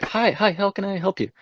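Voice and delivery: high, soft imitation of a receptionist; phone employee. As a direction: mimic her greeting up high and softly. Imitating pizza place employee.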